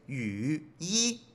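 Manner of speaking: long YU dipping and rising, then separate YI; overdone whole dip on "yu" before "yi"; slow, forced "yu, yi" with a break; full third tone. Shown as a demonstration. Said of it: "antes es".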